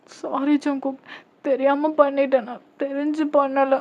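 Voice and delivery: high, wailing excuse; tearful apology. Acted. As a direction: plead innocence in an acted cry. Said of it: "அப்படின்னு சொல்லி அழுவுறீங்க".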